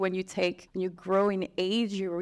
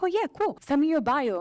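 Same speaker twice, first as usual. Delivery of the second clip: breezy reply; 8 semitones higher, 3 dB louder than usual. Retold or imitated. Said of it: "I was like".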